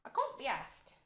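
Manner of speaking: put-on British accent. Streamer repeating herself for chat, in a British accent.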